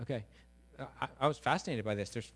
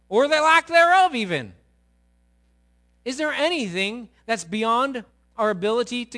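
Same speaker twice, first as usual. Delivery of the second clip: louder, higher rhetorical questions, rising in force; building preaching questions; 8 semitones higher, 11 dB louder than usual. Acted. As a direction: hammer the congregation with questions.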